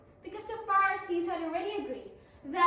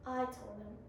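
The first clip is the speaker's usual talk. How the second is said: plain reading voice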